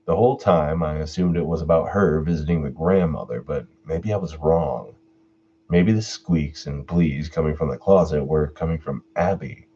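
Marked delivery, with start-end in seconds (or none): none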